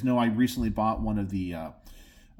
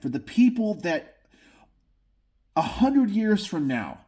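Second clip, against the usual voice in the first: passionate; 5 semitones higher than usual. Real, not acted. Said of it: "if I sound passionate".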